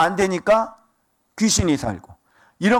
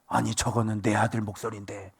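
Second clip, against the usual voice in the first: low, hushed acted murmur; quiet father's aside; 13 semitones lower, 10 dB quieter than usual. Preacher playing a father who hears his son's voice, murmuring low. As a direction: drop right down, as if noticing to yourself.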